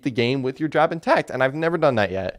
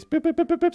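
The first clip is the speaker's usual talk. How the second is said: rapid high-pitched beeps; imitated beeps; 3 dB quieter, faster than usual. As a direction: mimic quick electronic game blips. Imitating tapping on a tablet game.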